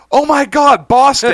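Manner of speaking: high, loud delighted squeal of recognition; excited recognition. Retold or imitated. Barista spotting a regular, high excited squeal. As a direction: shriek the name like spotting an old friend. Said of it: "And she goes".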